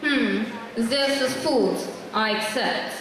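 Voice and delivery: slow, stiff Russian approval; Russian accent. Acted. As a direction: accept the food like a stern Russian. Russian character tries food.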